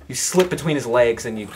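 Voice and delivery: ordinary delivery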